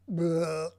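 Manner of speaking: loud, put-on retching "bleh"; mock-disgusted bleh. Reacting to the finished mouth, with a loud mock-disgusted bleh. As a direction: blurt out a loud noise of disgust.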